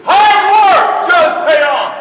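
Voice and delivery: slow, loud, high shout on HARD; emphatic shout. Shown as a demonstration. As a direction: hammer home Hard work slowly and loudly. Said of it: "Did you hear what I just said?".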